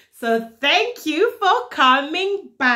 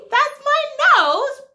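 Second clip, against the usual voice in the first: high, bright mock surprise; overbright kids party host; 10 semitones higher, 3 dB louder than usual. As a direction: squeal it for toddlers.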